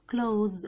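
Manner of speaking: "كلوز" in one syllable, s voiced as z; correct pronunciation. Shown as a demonstration. Teacher shows it one last time, correctly pronounced "كلوز". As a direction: finish "كلوز" on z with a slight smile. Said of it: "وفي الاخر ابتسامة خفيفة".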